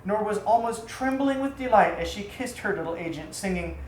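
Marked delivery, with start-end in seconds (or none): none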